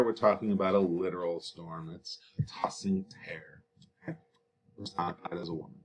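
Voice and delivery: soft unvoiced whisper; a hushed teacher's aside. Real, not acted. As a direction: lean in and whisper it.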